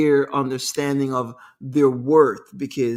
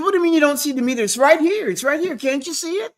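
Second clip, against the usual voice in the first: high, fast, loud indignant snap; indignant outburst; 10 semitones higher, 3 dB louder, faster than usual. Retold or imitated. Taxi driver snapping at a passenger, indignant and high. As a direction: retell the driver's indignant outrage, high and quick. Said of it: "he got so indignant".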